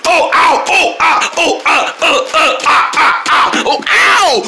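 high yelps of pain, tumbling down; screaming while falling. Acted. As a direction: cry out at every bump of the fall. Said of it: "I'm gonna fall down the stairs".

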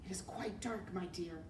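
soft, hushed rat; whispered. A narrator's reading. Rat answers the girl in the dark, hushed. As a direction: keep it low and quiet. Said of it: "whispered Roscoe from her pocket".

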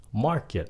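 MARKET said as MAR-ket; stress on first syllable. Shown as a demonstration. Teacher shows learners MAR-ket, first syllable stressed. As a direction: hit the MARK in MARKET. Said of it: "nhấn âm một trong cái âm mark".

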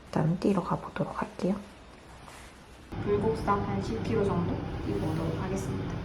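soft unvoiced whisper. Real, not acted. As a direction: breathe the words out quietly. Quiet aside before leaving.

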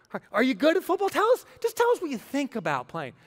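high, loud mock-eager questioning; mock interviewer. Recruiters quizzing football players, mocking high-pitched voice.

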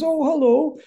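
put-on high, loud Scottish-accented greeting. Actor looks over and greets his surprise stage partner, Scottish accent. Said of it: "in his Scottish accent or whatever".

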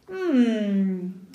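drawn-out, thoughtful "mm"; long musing hum, gently falling; pondering hum. A narrator's reading. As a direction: hum to yourself while considering. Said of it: "se dijo el zorro a sí mismo".

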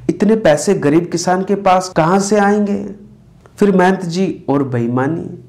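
usual speaking voice

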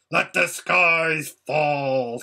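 slow, drawn-out defiant cry; shrieked defiance. A narrator's reading. Rabadash defying Aslan, slow and drawn out. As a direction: spit each word out slowly. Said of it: "shrieked Rabidash".

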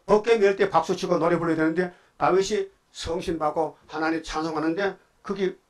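normal talking voice

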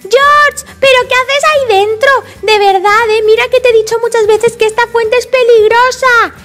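high-pitched big-sister telling-off; scolding. Acted. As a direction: tell off a little brother, exasperated. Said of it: "no es momento de reñirle".

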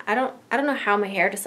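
ordinary talk

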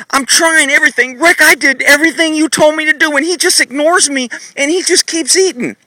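high whiny complaint to Rick; mock complaining. Shown as a demonstration. Fake owner complaining to trainer Rick, high whine. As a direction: play the helpless owner whining. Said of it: "what most people do".

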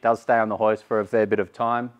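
ordinary delivery

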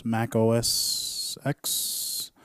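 slow drawn-out announcement. Pretend. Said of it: "I was just playing around".

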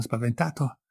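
ordinary delivery, nothing marked

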